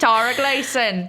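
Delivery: put-on Cockney English voice; Cockney accent. Shown as a demonstration. Host shouts out a listener on request, Cockney accent. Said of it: "Tara specifically asked for my Cockney accent".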